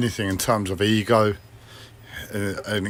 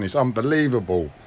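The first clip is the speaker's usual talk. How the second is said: usual speaking voice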